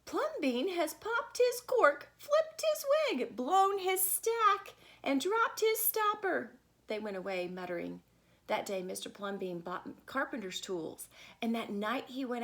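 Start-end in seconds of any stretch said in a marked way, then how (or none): 0.1-6.5 high, loud, slow scandalised gossip; scandalised neighbours. A narrator's reading. The neighbours gossip that Plumbean has lost his mind. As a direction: drag out each phrase in shocked outrage. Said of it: "The people said".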